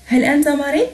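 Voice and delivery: rising question tune; question intonation. Shown as a demonstration. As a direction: lift "مريض" as a question. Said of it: "أغير فقط نبرة صوتي".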